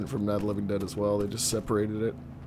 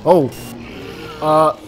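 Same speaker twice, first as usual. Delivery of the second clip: loud high panic shouts; panicked yelling; 8 semitones higher, 12 dB louder than usual. Real, not acted. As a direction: scream in alarm as they attack. Sudden zombie ambush, panicked yelling.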